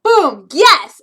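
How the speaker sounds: loud triumphant cheer; excited shout. Retold or imitated. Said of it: "Monica's going".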